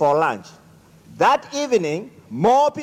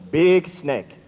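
usual speaking voice

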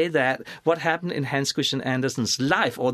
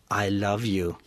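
lower, hushed declaration of love; tender plea; 5 semitones lower than usual. Acted. Reading Andersen's letter to Henriette, tender and soft. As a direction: say it tenderly, low and unhurried. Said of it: "in your most tender and soft voice".

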